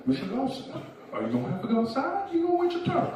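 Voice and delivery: high-pitched, exasperated scolding; Southern old-timer chiding an unruly man; older Southern gentleman. Retold or imitated. Man in charge telling the drunk to wait, high-pitched Southern scolding. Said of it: "an older Southern gentleman".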